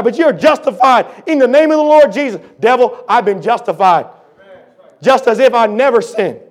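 loud, high, pounding sermon delivery; preaching at full force. Acted. Preacher firing back at the devil, full-force preaching. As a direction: hammer each claim at the devil.

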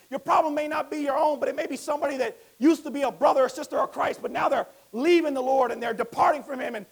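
loud, high, driving preacher voice; full-force preaching. Acted. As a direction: preach it hard to the congregation.

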